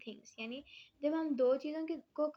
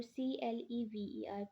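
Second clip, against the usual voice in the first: spelling out; 4 dB quieter than usual. Shown as a demonstration. Teacher spelling out a word.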